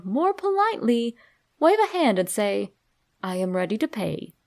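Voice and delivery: ordinary delivery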